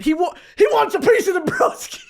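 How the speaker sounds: loud, high squawk; hammed-up boast. Woman bragging that he's into her, squawking boast. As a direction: crow it in a silly high voice.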